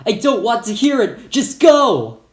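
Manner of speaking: high, loud, furious dismissal; shout, building to a very loud finish; acted shout. Principal throwing teacher out, shouting. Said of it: "he shouted".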